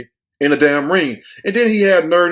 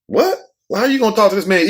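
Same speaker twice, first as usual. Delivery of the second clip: shocked disbelief; 5 semitones higher, faster than usual. Retold or imitated. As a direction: pitch leaps up on a stunned what, then a fast outraged question. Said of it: "I'm like".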